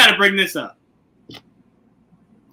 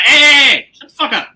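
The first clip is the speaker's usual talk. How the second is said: mock yell shutting the dragon up, higher and louder; mock scolding; 8 semitones higher, 7 dB louder than usual. Rhaenyra scolding her dragon, mock yell. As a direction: snap at the dragon, half-playing. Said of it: "was like".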